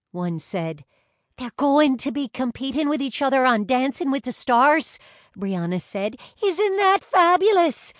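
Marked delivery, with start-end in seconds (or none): 1.4-5.0 high, loud excited news; gushing fan. A narrator's reading. Brianna telling everyone about the twins' contest, gushing. 6.4-7.9 very high, loud fangirl squeal; excited squeal. A narrator's reading. Brianna thrilled for the famous twins, squealing. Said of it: "she squealed".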